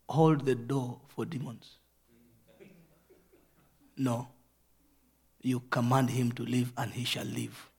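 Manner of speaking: ordinary talk, nothing marked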